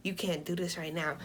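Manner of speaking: low, very soft, firm pleading; hushed reproach. Retold or imitated. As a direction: talk her down under your breath. Talking a frantic friend down.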